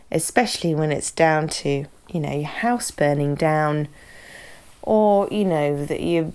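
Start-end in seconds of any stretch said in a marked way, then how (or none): none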